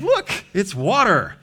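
put-on high, delighted kid voice; childlike wonder. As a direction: marvel like a child at water.

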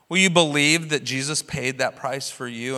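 usual speaking voice

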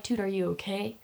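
soft, hushed worried check; concerned. Retold or imitated. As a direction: ask gently if she's hurt. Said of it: "I'm like".

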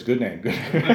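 quoting a friend through a laugh; laughing quote. Retold or imitated. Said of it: "Kevin says".

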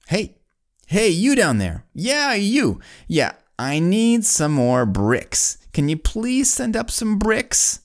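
high-pitched calling down from a height; mock yell down. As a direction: holler to a worker far below, playfully acted. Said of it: "yells down again".

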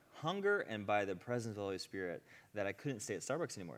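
ordinary talk, nothing marked

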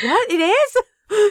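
high, laughing surprise; laughing disbelief. Retold or imitated. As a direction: squeal it through laughter. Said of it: "I was like".

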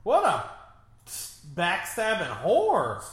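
loud, high, slow outraged yell. Real, not acted. Viewer yelling at a traitor on screen, outraged. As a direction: hurl the insult at the screen.

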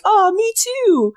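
high, slow mock "oh, me too"; relating squeal. Fan relating to Dean's frustration, high slow squeal. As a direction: squeak it out like you totally relate. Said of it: "I was like".

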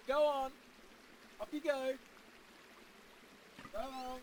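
high, soft fed-up dismissal; throwing him out. Acted. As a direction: coldly show the guest the door. Host throws out guest Paul.

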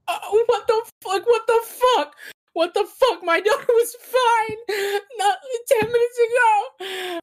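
very high-pitched hysterical wailing; in a tizzy. Acted. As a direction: shriek in frantic disbelief over a stricken daughter. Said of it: "in a tizzy. She's like".

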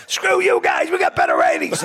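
cocky jeering shout; gloating heckle at the neighbours; mock taunt. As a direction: rub it in to the rivals. Taunting rival show.

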